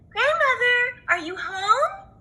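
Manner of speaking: put-on little girl calling into the house; high girl voice.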